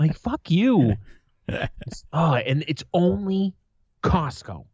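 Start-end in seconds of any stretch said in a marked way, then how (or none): 0.2-0.9 high, punched angry retort; mock outburst, pitched up; retold snapping. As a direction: fling it out like you've lost patience. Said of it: "I snapped and was like".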